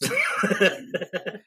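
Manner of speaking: high bursting laugh; big laugh at own joke. Real, not acted.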